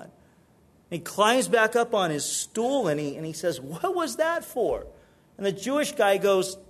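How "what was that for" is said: higher, slow, bewildered question; a mild hurt what-was-that-for; puzzled Chinese guy. Acted. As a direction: ask why you were hit, genuinely baffled. Chinese guy punched, asks why.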